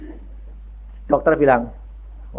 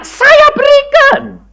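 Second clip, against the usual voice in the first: 1 Abraham offering; 3 dB louder, much slower than usual. Retold or imitated. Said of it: "Abraham anaknya diminta".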